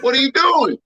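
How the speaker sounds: shocked yell. Retold or imitated. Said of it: "I was like".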